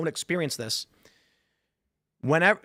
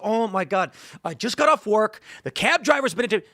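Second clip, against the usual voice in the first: high, louder rant of a fed-up husband; exasperated outburst; 7 semitones higher, 3 dB louder than usual. Acted. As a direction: act fed up, climbing in pitch and volume. Acted tired husband snapping at wife.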